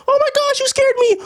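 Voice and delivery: put-on very high squealing girl voice; high falsetto fright, hammed-up; mock startled woman.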